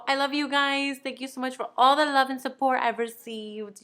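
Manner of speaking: usual speaking voice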